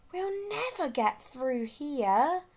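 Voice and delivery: dismayed exclaim. A narrator's reading. Gasper stuck in the ice, slow dismayed exclaim. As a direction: moan that they're stuck. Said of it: "exclaimed Gasper".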